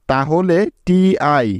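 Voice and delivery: TI stretched letter by letter; teacher spelling TI slowly. Shown as a demonstration. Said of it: "মিলে হবে টি".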